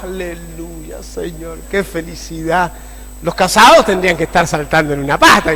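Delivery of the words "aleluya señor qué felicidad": mock blissful hush. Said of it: "Yo empezaba, los hermanos".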